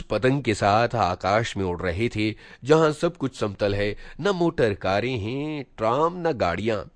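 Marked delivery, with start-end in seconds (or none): none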